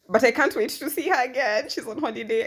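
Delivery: high, loud burst of eagerness; can't wait, bursting with it; eager. Real, not acted. Vlogger eager to see her therapist again, excited. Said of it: "eager about seeing therapist".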